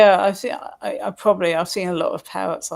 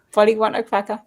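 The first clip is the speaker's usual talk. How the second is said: mock parrot; 3 semitones higher than usual. Parrot catchphrase.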